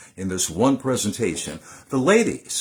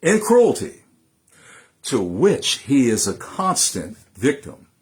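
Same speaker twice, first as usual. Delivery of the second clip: louder, rising and falling orator's delivery with long pauses; thundering nineteenth-century oratory; impassioned oration; 3 semitones higher, 5 dB louder than usual. Acted. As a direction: deliver it like a fiery speech to a hall. Recites Douglass's Fourth of July speech.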